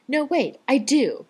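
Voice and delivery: high, loud, overdone sarcasm; exaggerated. A narrator's reading. Rodney mocking John beside the hospital cot, exaggerated. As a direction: ham up the outrage. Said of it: "Rodney says with exaggerated effect".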